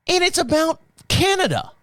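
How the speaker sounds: high-pitched mock British bartender; Scottish-turned-British accent. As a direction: imitate a Scottish woman, sounding British. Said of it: "it's gonna sound British, I know".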